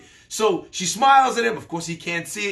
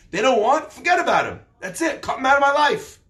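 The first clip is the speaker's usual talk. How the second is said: higher, louder fed-up outburst; acted indignation; 5 semitones higher, 3 dB louder than usual. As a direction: act out writing someone off, voice pitched up. Acted fed-up reaction to being snubbed.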